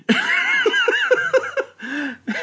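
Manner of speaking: high burst of real laughter; big laugh. Real, not acted. Laughing about the villain being just mean, a big real laugh. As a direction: let a genuine laugh break out.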